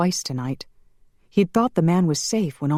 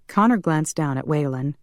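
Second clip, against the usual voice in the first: plain reading voice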